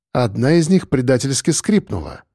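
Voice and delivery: ordinary delivery, nothing marked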